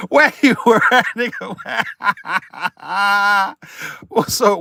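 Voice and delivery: helpless real laughter breaking up the words; cracking up. Real, not acted. Streamer spots a wacky name in chat, cracking up. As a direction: laugh right through the words.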